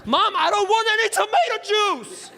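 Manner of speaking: high, exasperated outburst; losing it. Retold or imitated. Son finally losing it over the tomato juice, high and exasperated. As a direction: snap with built-up frustration. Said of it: "I lose it".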